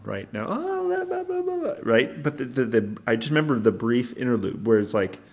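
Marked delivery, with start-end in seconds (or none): 0.5-1.7 high-pitched mock chit-chat; fake happy chatter. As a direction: imitate someone switching on a social mask. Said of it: "the mask came back on".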